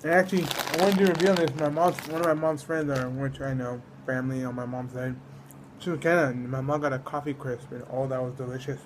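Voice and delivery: ordinary talk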